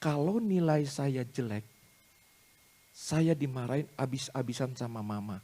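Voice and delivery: put-on slow, subdued child's confession; pupil confessing. Scolded pupil explains the tears to the tutor, softly retold. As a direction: retell it softly, haltingly, as the pupil. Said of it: "Dia katakan".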